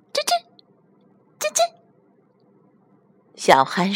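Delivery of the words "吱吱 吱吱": tiny, very high mouse-like squeaks. Acted. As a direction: squeak like a little squeeze toy. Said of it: "吱吱叫". (尖尖细细的吱吱叫声)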